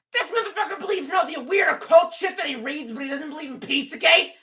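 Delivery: high, squeaky-pitched indignant rant; angry outburst. Acted. Streamer raging at a viewer's Pizzagate doubt, angry outburst. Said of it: "I got angry there for a minute".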